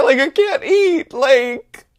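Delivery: high, loud, drawn-out mock wail; hammed-up squealing panic; freaking out. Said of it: "I'm freaking out".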